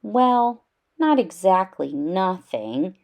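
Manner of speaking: plain reading voice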